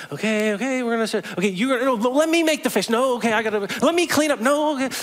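high, awkward fumbling as Peter; flustered Peter. Retold or imitated. Peter fussing at breakfast, high and awkward. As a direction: pitch way up, nervously flustered. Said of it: "Peter being like".

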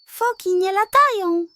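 high, loud, mocking laugh through the words; the gull scoffs at the seal's dream. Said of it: "zaśmiała się".

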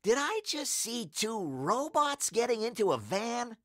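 put-on high, disbelieving boy; incredulous question. A narrator's reading. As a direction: ask as if doubting your eyes. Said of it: "asked Harold".

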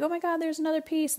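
a touch of mock kid excitement; excited kids. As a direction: mimic kids spotting another piece. Kids excited finding garbage.

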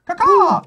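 high, loud bird caw; imitated hawk cry. Player announces hawk card, high loud cawing. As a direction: screech out a mock hawk call.

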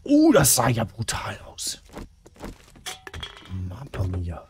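slow breathless whisper; hushed and awed. Real, not acted. Whispered reaction. (flüsternd)

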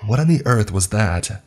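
a hint of panic as she runs; terrified. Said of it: "she asked, terrified".

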